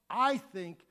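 normal talking voice

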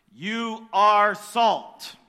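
very slow "you are salt", "are" stressed loud; emphatic pulpit declaration. Shown as a demonstration. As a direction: lean hard on each word. Preacher drives home the point.

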